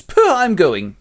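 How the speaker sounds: huffy. Acted. Fairy queen walking out on Oberon, huffy. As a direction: puff out an offended exit. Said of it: "And she goes".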